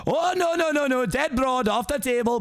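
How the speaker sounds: high, protesting Scottish cry; Scottish Shrek. Acted. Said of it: "very generic Scottish".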